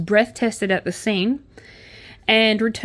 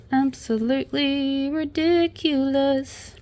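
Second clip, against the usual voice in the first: slow, higher, stretched syllables; drawn-out disapproval; 4 semitones higher, slower than usual. Real, not acted. Commenting on a tacked-on news story, drawn-out exasperation. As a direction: draw each word out in exasperation.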